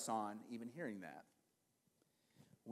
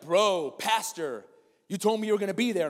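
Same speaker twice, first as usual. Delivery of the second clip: lightly voiced running buddy, a bit louder; mock nagging friend; 5 semitones higher, 9 dB louder than usual. Lewis reminding the pastor he promised, mock scolding. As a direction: tease him like a friend calling him out.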